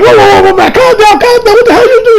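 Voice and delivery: high, loud panicked yelling to stop the fight; panicked shouting. Retold or imitated. Josuke yelling at the attacker to stop strangling Koichi, panicked.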